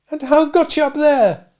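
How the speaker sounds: put-on high kindly old dame voice; a cottage dame fussing over a boy. Old dame offers the sooty boy milk, high and kindly.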